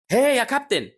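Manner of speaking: high, loud call to the captain; shout. Acted. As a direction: cry out to get the driver's attention. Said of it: "ويصرخ هازر وبيقول".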